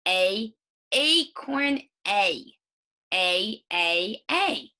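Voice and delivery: "A" said like its letter name, as in "acorn"; long A sound. Shown as a demonstration. Teacher showing the vowel saying its name, long "A". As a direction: sound out long "A" in separate, emphatic beats. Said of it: "The long sound is the one".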